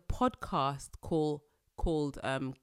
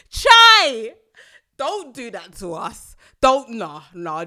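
much louder, higher "Chai!", then pleading; appalled exclamation; 5 semitones higher, 16 dB louder, slower than usual. Real, not acted. Nigerian host hears the twenty-eight percent claim, loud appalled outcry. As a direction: cry out in mock horror.

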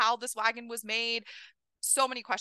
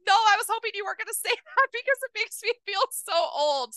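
laughing dismay; 11 semitones higher, 8 dB louder than usual. Real, not acted.

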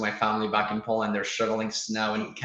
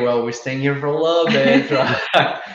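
laughing; 4 semitones higher, 8 dB louder than usual. Real, not acted. Joking about debt, laughing.

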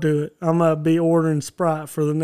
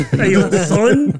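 slow, laughing Jersey drawl; laughing mock Jersey; 3 semitones higher, 3 dB louder, much slower than usual. Co-host laughing while aping a Jersey tough guy. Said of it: "New Jersey, Brooklyn area".

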